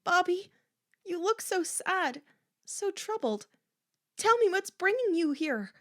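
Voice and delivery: high, anguished appeal; troubled, imploring tone; pleading. A narrator's reading. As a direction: plead with her, voice lifted high. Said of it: "Corky pleaded".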